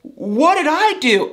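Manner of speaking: high, slow, wide-eyed "what did I do"; mock stunned innocence. Mother-in-law reacting after Natalie walks out, high, slow stunned innocence. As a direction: play it like she just saw a ghost. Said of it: "Trish sat there like she just saw a ghost".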